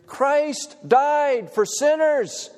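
drawn-out, high ringing preacher line, slow; preacher's proclamation. Retold or imitated. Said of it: "from a preacher like right now".

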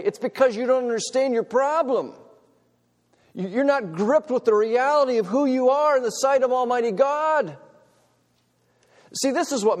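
high, loud impassioned pulpit voice; full-force preaching. Acted. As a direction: preach it hard to the congregation.

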